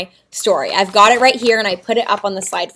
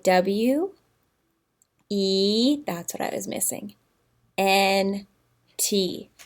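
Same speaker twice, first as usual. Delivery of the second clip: soft spelling; 4 semitones lower, 7 dB quieter, slower than usual. Shown as a demonstration. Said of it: "You can whisper it".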